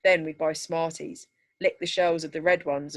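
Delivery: ordinary delivery, nothing marked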